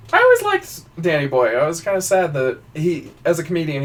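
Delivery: ordinary talk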